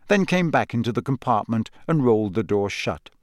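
ordinary delivery

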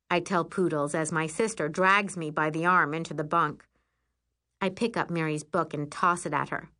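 usual speaking voice

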